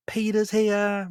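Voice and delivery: put-on high, slow, gushing girl voice; excited Gwen. Gwen greeting Peter, high and gushing. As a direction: squeal his name with delight.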